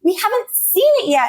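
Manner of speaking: high mock protest; protesting friend. Friends protesting the spoiler, high mock whine. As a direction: object with a high whiny edge.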